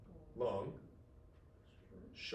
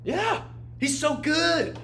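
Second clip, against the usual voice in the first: gushing praise; 11 semitones higher, 6 dB louder, much slower than usual. Real, not acted. Gushing about the poet.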